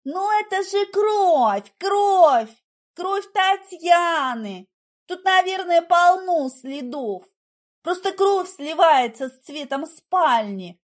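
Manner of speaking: high, loud, drawn-out pleading; agitated insistence. A narrator's reading. Heroine convincing Igor about Tatiana's blood, agitated. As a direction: insist frantically, stretching the key words.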